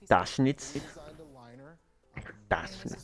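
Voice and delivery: put-on testing out a German accent; searching German accent. Player warming up before the given sentence, tentative German accent. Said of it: "let me find a German accent".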